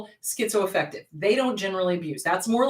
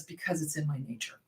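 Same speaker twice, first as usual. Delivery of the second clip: low, soft, cold admission; menacing scorpion; 7 semitones lower, 12 dB quieter than usual. Acted. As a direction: drop the voice, quietly sinister. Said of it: "the scorpion looks at him and says".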